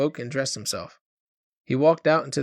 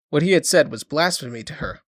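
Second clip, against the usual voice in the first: ordinary delivery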